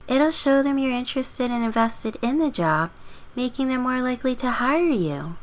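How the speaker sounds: put-on high, girlish, helpful advice; neighbor girl advising. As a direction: pitch it up as the well-meaning girl next door.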